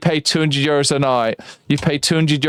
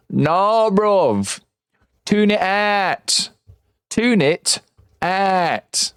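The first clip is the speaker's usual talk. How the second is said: London slang; 6 semitones higher, much slower than usual. Retold or imitated. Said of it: "they're just like".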